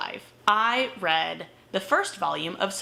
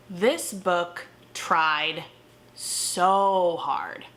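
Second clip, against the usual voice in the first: usual speaking voice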